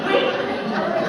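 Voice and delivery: loud and high through laughter; laughing. Real, not acted. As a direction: squeeze the words out laughing. Laughs at her own slip.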